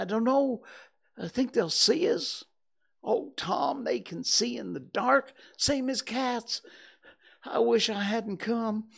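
fretful fear rising; scared. Acted. Huck wishing he hadn't come, high frightened voice. Terrified boys in graveyard.

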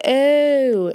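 high, reenacted "oh" of realisation; retold realisation. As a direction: let it dawn on you aloud.

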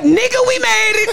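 hammed-up victory cry; mock triumphant. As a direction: cheer it like you finally made it. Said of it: "I'ma be like".

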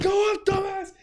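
high, shouted insult; mock angry yell. Injured coworker yelling at the crew, high mock shout. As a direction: scream at the crew to lift it. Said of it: "he's like".

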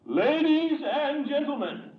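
booming slow ringmaster call; ringmaster announcement. Acted. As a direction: announce grandly to the crowd. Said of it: "That's my cook, folks".

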